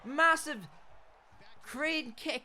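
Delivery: high, loud, slow stretched call; excited drawn-out shout. Real, not acted. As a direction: draw the words out in awe. Excited fight commentary.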